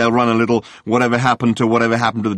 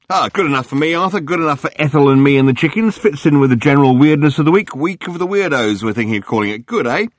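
gushing tabloid hack; 6 semitones higher, 4 dB louder than usual. Acted. Journalist Murray greeting Arthur on the phone, gushing. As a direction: lay the flattery on thick. Murray voiced, high and chatty.

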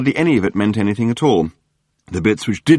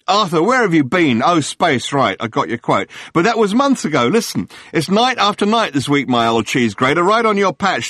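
gushing tabloid hack; 6 semitones higher than usual. Acted. Journalist Murray greeting Arthur on the phone, gushing. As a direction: lay the flattery on thick.

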